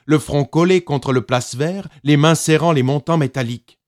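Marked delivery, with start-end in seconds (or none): none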